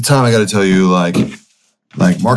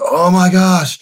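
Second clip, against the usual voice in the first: high, strained moan of a hangover; miserable groan; 12 semitones higher, slower than usual. Retold or imitated. Slumped on the couch after bar work, miserable high groan. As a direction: groan it out like you feel awful.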